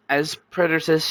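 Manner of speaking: plain reading voice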